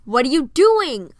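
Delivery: loud, slow, angry shout. A narrator's reading. Lady Kane yells at Piper mid-battle, in a loud angry shout. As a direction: bark it across the deck. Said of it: "Lady Kane shouted".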